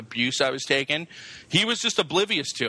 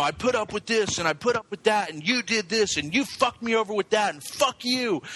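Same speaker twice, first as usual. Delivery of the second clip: pitched-up tirade of grievances; retold angry rant; 6 semitones higher than usual. Telling the whole office off in the retelling, angry. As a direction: reel off the grievances in a higher, angry voice. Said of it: "I was so angry".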